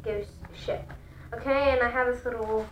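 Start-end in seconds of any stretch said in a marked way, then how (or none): 0.0-0.9 whispering; breathy whispered spooky title. Shown as a demonstration. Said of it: "repeats title, whispered".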